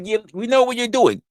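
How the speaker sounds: ordinary talk, nothing marked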